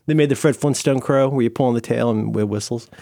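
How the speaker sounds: ordinary delivery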